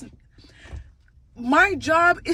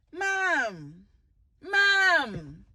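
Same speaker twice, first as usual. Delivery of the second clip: exasperated ma'am; 3 semitones higher than usual. Retold or imitated. Acted reaction to nosy coworker.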